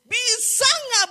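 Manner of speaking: loud, high, stretched-out plea; slow acted wail; imitated crying plea. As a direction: imitate a desperate woman begging through tears. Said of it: "sambil nangis".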